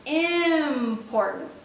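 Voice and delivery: Important said slowly and drawn out; slowed for emphasis. Shown as a demonstration. Teacher repeating slowed Important. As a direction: stretch Important to stress it. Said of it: "That's how you emphasize".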